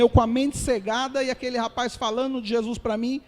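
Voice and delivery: usual speaking voice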